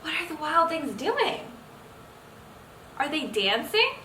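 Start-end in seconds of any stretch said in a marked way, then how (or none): none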